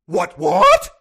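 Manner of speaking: very high, very loud incredulous shriek; a hammed-up cry of confusion; baffled outburst. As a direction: shriek it in sheer disbelief. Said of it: "you play the unnamed person".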